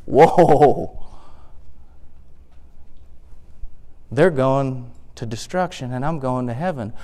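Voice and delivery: low, soft, awed whoa; hushed realization. Acted. Asaph shown the whole picture by God, hushed awe. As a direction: let it dawn slowly, voice dropped in awe. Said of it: "And Asaph goes".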